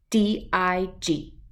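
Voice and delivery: slow letter by letter; spelled out. Shown as a demonstration. Teaching a dog to spell, letter by letter slowly.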